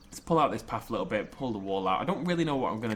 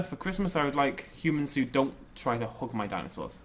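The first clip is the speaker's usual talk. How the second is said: ordinary talk, nothing marked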